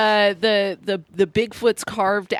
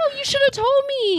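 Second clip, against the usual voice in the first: playfully aggrieved cry; exclaimed reproach. Real, not acted. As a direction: wail it like a let-down friend. Reacts to unannounced visit to hometown.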